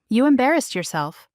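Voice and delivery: ordinary delivery, nothing marked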